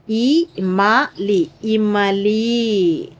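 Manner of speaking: ఈ మ లి split into slow syllables, then ఇమలి joined. Shown as a demonstration. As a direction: spell ఇమలి out very slowly for the class. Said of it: "అర్థమైందమ్మా?".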